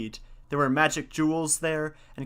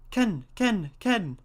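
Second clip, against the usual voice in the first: high squawking bird call; pheasant cry; 7 semitones higher, slower than usual. Acted. Pheasant flies over gate.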